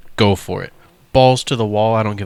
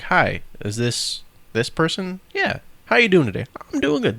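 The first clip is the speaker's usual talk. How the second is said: higher, sing-song fake-cheery caller; mock telemarketer; 7 semitones higher than usual. Imitating telemarketers who get you talking, mock cheery. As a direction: play a pushy phone salesman hooking you. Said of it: "even fucking telemarketers do this now".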